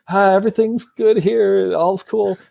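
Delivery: high-pitched, awkwardly casual bluff; Han Solo. Retold or imitated. Han bluffs the manager over the intercom, falsely casual. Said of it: "And Han Solo's like".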